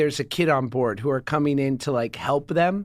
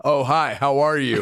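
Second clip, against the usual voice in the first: mild imitation of a teen's deep hello; lightly mock the deep-voiced teen; low teen voice; 3 semitones lower than usual. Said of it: "Don't give him a".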